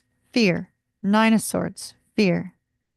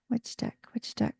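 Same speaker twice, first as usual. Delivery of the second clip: whisper; 8 dB quieter than usual. Real, not acted. Muttering to herself choosing deck.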